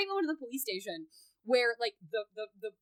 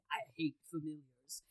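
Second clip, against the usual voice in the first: low, quiet deadpan delivery; softly voiced movie tough guy; imitating Blade; 8 semitones lower, 9 dB quieter than usual. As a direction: say it low and under your breath, in character. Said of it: "he gets to say".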